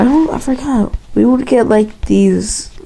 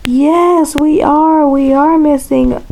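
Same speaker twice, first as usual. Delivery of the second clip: higher-pitched mock teacher voice; imitated teacher; 7 semitones higher, slower than usual. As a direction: put on an eager, high teacher's reply. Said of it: "my teacher was all like".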